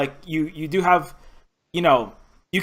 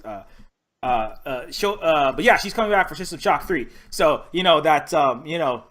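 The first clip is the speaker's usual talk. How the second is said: usual speaking voice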